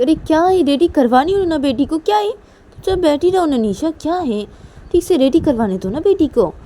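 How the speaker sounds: high, loud, exasperated; irritated retort. Acted. Manisha to Nisha while dressing the girl, exasperated. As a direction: fend off the nagging crossly.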